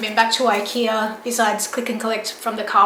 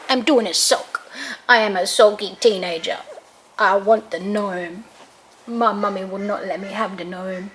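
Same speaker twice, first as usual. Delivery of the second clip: sulky dog voice; 3 semitones lower than usual. Pretend. Mother voicing her sulking dog, low and sulky. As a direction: grumble as the moody pet.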